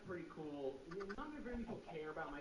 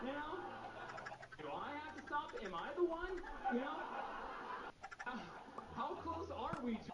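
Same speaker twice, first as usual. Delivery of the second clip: mock panic; 8 semitones higher than usual. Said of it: "mock panic over old driver".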